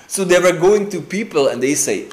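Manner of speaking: usual speaking voice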